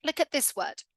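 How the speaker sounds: ordinary talk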